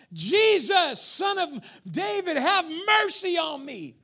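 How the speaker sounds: loud pleading cry for mercy; a blind beggar crying out to Jesus. Retold or imitated. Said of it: "he began to cry out".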